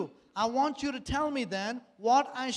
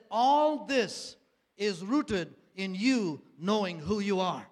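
slow emphatic prophecy; 5 dB louder, slower than usual. Retold or imitated. Retelling prophet's solemn word.

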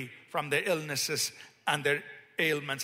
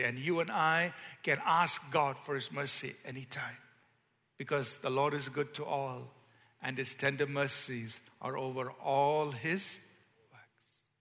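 ordinary talk

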